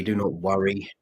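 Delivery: ordinary delivery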